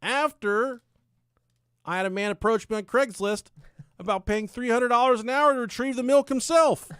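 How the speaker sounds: high, loud storyteller's proclamation; hammed-up, projected narration; dramatic declaiming. Real, not acted. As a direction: proclaim it like an epic tale.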